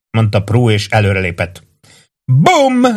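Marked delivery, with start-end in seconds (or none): 2.2-2.9 very high pretend gunshot yell; playful high-pitched bang; mock roar. A narrator's reading. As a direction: shout a make-believe shot. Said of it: "ordította az alak".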